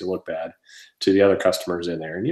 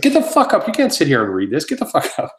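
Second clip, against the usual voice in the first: retold pissed-off; 7 semitones higher than usual. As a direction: tell him off in a raised, much higher voice. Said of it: "somebody goes over and gets pissed".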